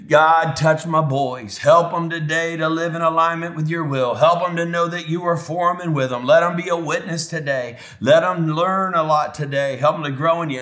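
imitating a father's fervent morning prayer; dad's prayer. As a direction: pray aloud over your kids, voice lifted. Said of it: "He'd pray like that".

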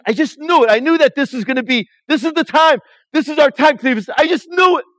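high, loud, passionate insistence; a disciple crying out how sure he'd been; fervent exclamation. Acted. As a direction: pour out the hope you had. Disciple recalling dashed hope.

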